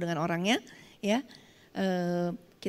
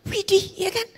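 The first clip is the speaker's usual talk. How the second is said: shocked exclamation; 10 semitones higher, 4 dB louder than usual. Real, not acted. Shocked at ironing burns.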